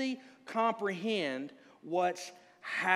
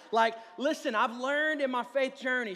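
ordinary delivery

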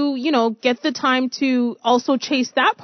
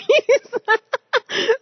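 big laugh; 12 semitones higher than usual. Real, not acted. Host bursts out laughing.

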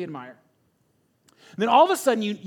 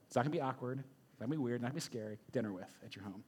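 soft reassurance; 8 semitones lower, 13 dB quieter, faster than usual. Real, not acted. Reassuring listeners about dream dinner.

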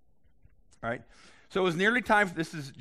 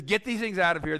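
a touch louder and higher, driving them out; forceful command; 6 semitones higher, 7 dB louder than usual. Retold or imitated. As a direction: bark the order at the merchants. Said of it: "So he says".

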